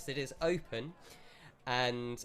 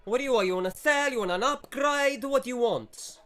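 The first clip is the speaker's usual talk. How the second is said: louder, higher, fast mock Italian shopkeeper; shopkeeper accent; 11 semitones higher, 7 dB louder than usual. Shopkeeper brushes off customer, louder fast mock Italian accent.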